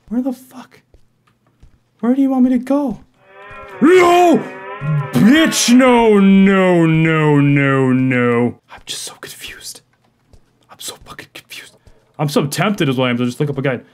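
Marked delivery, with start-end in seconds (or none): 3.8-8.6 panicked shout. Real, not acted. Gamer caught by the monster, panicked shouting. 8.7-11.8 unvoiced frustrated whisper; exasperated whisper. Real, not acted. Gamer lost after a scare, exasperated whisper.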